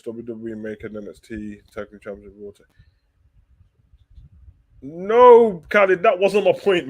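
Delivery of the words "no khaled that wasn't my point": higher, louder exclaimed objection; protesting to a viewer who got his point wrong, loudly. Real, not acted. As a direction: shout down the misreading of his point. Correcting a viewer's misreading.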